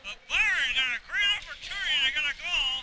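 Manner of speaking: talking around a pen in mouth; words muffled by a pen; pen in teeth. Real, not acted. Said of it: "a pen in my mouth, so I can't really call much".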